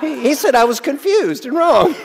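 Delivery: high, loud, laughing complaint; playfully tattle to the class; laughing mock outrage. Teasing the class about a participant's remark, laughing. Teacher mock-reporting a rebuke.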